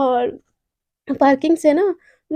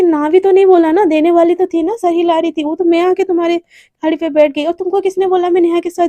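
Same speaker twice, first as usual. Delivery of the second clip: jealous scolding; 3 semitones higher, 5 dB louder than usual. Acted. Jealous Devika scolding Radhika in the car, loud. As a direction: scold her possessively.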